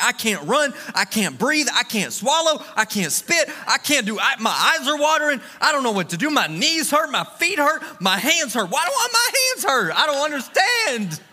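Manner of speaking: mounting high-pitched exasperation, complaint piled on complaint; frustrated. Retold or imitated. Runner retelling his mid-race meltdown, frustrated and climbing. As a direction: build a hammed-up rant to a high wail at the end. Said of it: "I was so frustrated in that moment".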